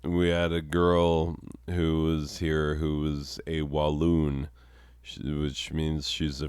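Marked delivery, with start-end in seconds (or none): none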